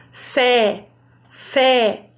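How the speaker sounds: fé with a wide open E, mouth opened. Shown as a demonstration. Teacher showing children the open vowel, drawn out. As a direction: stretch the open E of fé. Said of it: "Eu tô fazendo até com a mão porque ele é representado pelo movimento da boca".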